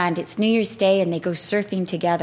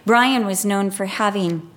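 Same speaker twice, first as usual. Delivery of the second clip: ordinary talk, nothing marked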